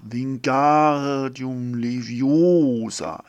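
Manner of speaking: loud, very slow, drawn-out vowels; spell incantation. A narrator's reading. Little girl levitates a feather, drawn-out spell incantation. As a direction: swell on each long vowel as you cast.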